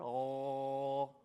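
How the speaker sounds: long throaty gargle; gargling ghain. Shown as a demonstration. Teacher shows how to find the ghain, gargling sound. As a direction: gargle as if with water. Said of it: "gargle water".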